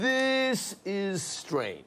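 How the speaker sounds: slow inner thought. Retold or imitated. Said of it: "I thought to myself".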